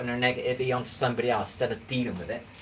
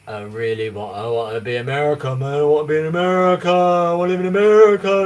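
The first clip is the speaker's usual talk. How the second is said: imitating his kid self, loud and higher, pleading; childhood wish; 3 semitones higher, 9 dB louder than usual. Streamer retelling childhood wish for America, loud mock plea. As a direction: belt it out like a kid begging. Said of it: "That's what I was like".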